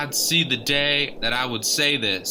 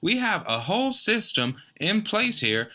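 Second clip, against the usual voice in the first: higher, indignant host scolding; flustered objection; 7 semitones higher than usual. Acted. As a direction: protest the intruder sharply.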